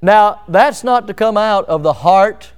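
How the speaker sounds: ordinary delivery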